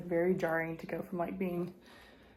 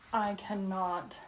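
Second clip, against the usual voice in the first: ordinary talk, nothing marked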